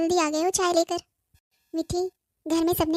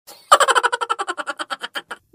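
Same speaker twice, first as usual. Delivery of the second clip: a high, louder mocking laugh; 5 semitones higher, 4 dB louder than usual. Mother-in-law laughing at the bride for waking late. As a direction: laugh at her for oversleeping.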